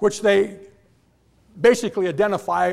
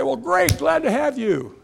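bright, higher mock greeting; cheery mock welcome; 7 semitones higher than usual. Church members greeting a visiting pastor with mock cheer. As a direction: welcome him like an eager usher. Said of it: "They say".

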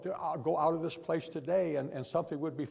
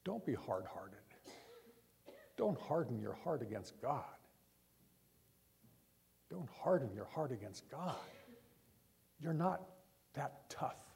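preacher softly begging listeners not to harden their hearts; quiet pleading; 2 semitones lower, 10 dB quieter than usual. Real, not acted. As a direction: plead gently with the congregation. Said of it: "I beg you".

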